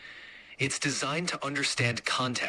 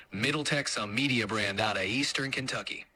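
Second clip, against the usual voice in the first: ordinary talk, nothing marked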